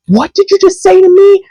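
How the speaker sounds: mock outraged girl snapping back. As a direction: imitate her losing it, pitch way up. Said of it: "She lost it".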